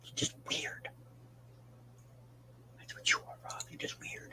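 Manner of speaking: whispered, soft and unvoiced; teasing whisper. Real, not acted. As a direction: breathe it like a secret jab. Teasing a chat user named Rob.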